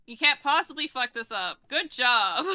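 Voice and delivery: loud happy congratulations; excited loud "good job"; loud praise. Real, not acted. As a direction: shout your praise across the room. Praising teammate after win.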